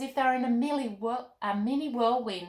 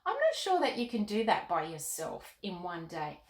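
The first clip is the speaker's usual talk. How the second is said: usual speaking voice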